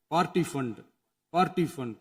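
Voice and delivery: usual speaking voice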